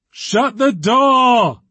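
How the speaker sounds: loud, slow angry yell. A narrator's reading. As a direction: bellow it at the intruder. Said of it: "yelled Jessica Weatherby".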